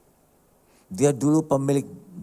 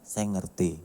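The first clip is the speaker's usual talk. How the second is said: gentle understanding; 10 semitones lower, 9 dB quieter, slower than usual. Acted. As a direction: lower and softer, quietly sympathetic. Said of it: "kita bilang".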